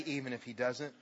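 hushed. Acted. Repeating the faith phrase.

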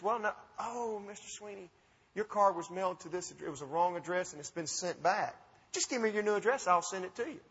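soft, soothing customer service lady; gentle imitation of a reassuring call-center lady; lightly voiced phone agent. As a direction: play the helpful operator, hushed and unhurried at first.

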